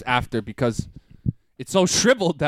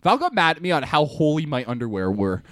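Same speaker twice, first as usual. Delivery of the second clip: normal talking voice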